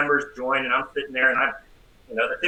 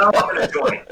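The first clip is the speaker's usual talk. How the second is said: insistent wife; 7 semitones higher, 4 dB louder, faster than usual. Retold or imitated. Wife insists on joining church.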